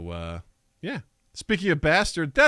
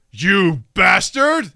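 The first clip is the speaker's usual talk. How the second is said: dramatic yell; 3 semitones higher, 7 dB louder, much slower than usual. Acted. Title shouted as exclamation.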